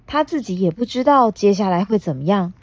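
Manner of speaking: plain reading voice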